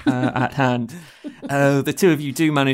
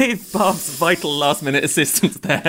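usual speaking voice

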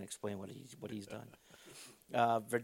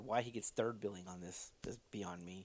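whispered aside; 3 semitones lower, 6 dB quieter than usual. Real, not acted.

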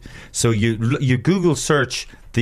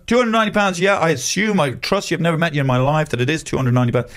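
higher, then rushing, naive trust; mock gullible victim; 4 semitones higher than usual. Mocking himself agreeing to the fee, high naive voice. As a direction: agree to anything, wide-eyed. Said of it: "mock victim trusting the scammer".

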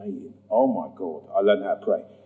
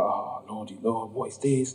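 hushed plea; 3 semitones lower, 11 dB quieter than usual. Retold or imitated. Bewildered quiet plea.

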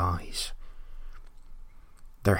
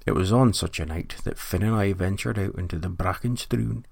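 usual speaking voice

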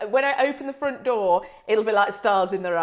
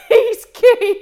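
laughing, much higher and louder; 11 semitones higher, 11 dB louder than usual. Real, not acted. Repeating the instructor's line, laughing. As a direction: squeal it through laughter.

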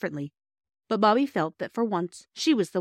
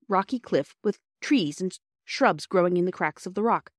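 ordinary delivery, nothing marked

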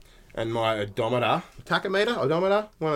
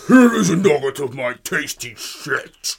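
loud, slow, hammed-up devil voice through a laugh; Satan voice; 5 semitones higher, 9 dB louder, slower than usual. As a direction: boom it out like a cartoon Satan, laughing. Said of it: "the Satan voice from South Park".

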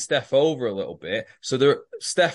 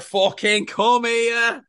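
higher, louder, slowed mock snap; mock snapping; 8 semitones higher, 4 dB louder, much slower than usual. Lynn dragging Flick away from Stu, mock angry snap. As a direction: yank her back like a toddler on reins. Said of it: "And Lynn's like".

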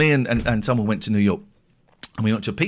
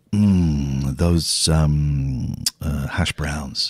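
slow, lower, relishing each word; dreaming about the buffet breakfast, savouring; 9 semitones lower, much slower than usual. Real, not acted. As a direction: linger over the food you love. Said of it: "savouring breakfast".

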